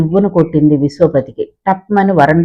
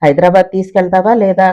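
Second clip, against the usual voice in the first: usual speaking voice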